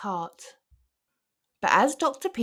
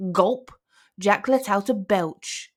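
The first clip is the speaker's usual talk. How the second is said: usual speaking voice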